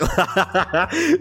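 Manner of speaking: high, real giggling burst; laughing. Real, not acted. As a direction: break up laughing mid-story. Laughs at the story.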